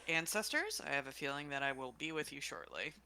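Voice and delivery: low muttered aside; under her breath. Acted. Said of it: "says under her breath".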